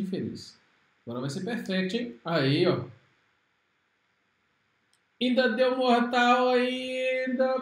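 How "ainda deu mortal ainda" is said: loud, drawn-out cry of triumph; excited shout. Real, not acted. Player yelling at the screen, loud and slow. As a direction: stretch it out loud as the finisher lands.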